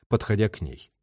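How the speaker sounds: ordinary delivery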